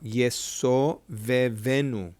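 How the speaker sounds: drawn-out teacher demo; slow sounding out. Shown as a demonstration. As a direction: sound out each syllable slowly, Yeso vevenu. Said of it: "let's sound it out".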